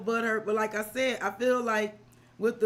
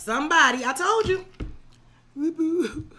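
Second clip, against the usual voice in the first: louder and higher, triumphant I-told-you; excited; 6 semitones higher, 6 dB louder than usual. Real, not acted. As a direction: crow that you called it. Card confirms earlier reading.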